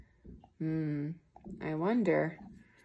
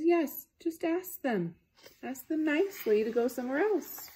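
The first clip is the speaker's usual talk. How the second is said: gentle advice; 8 semitones higher than usual. A narrator's reading. Piggy gently coaxing elephant to ask nicely. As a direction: coax a friend kindly.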